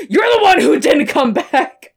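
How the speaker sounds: high, hammed-up angry rant; yelling at dad. Sam blaming his dad, high acted yelling. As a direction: build into a mock-furious outburst. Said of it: "yells at his fucking dad".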